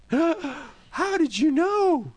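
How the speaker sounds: high, slow, drawn-out gasping question; shocked disbelief. Pretend. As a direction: play a stunned victim caught out. Repeat of mock shocked user.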